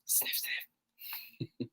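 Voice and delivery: whispered little sniffs; rabbit sniffing. Acted. Host imitating a rabbit for the kids, soft sniffs. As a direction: sniff lightly like a bunny.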